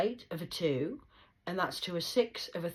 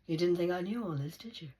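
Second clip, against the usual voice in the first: ordinary delivery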